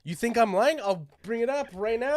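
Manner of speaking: high-pitched insistent protest; indignant challenge. Real, not acted. Challenged on his kanji story, high indignant insistence. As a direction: protest with rising, incredulous questions.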